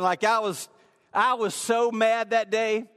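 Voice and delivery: ordinary delivery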